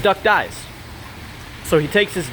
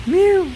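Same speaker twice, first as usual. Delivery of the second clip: high-pitched mew, held on one note; imitated cat meow; 5 dB louder than usual. Joke teller voicing the vet's cat, imitated meow. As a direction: do a small, very high cat's meow. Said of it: "and meows softly".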